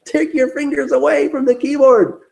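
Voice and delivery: high mock order, settling into a firm command; self-scolding. Warning himself away from the keyboard, mock stern. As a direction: order yourself sternly, half in jest.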